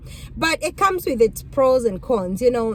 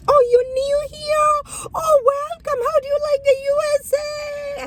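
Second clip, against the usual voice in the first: gushing welcome. Retold or imitated. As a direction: mimic an over-delighted local greeting a newcomer in a sing-song falsetto. Mimics gushing welcome to a supposed newcomer.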